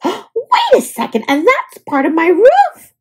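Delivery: high startled cry, voice jumping up; surprised woodpecker. A narrator's reading. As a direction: woodpecker suddenly spotting his roof. Woodpecker discovers roof piece.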